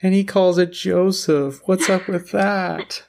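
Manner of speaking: very high, drawn-out fake wail; mock crying. Teasing over calling coffee Joseph, mock crying. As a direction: stretch it into a hammed-up sob. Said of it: "start crying".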